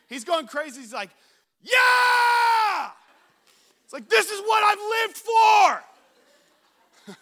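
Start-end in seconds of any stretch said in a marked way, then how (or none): 1.6-7.1 high, loud victory scream; ecstatic shout. Retold or imitated. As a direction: roar it out like a champion. Winner celebrating wildly.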